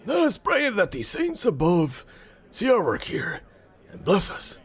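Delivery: high-pitched villager voice; hopeful villager. Acted. Voices villagers praying for rain.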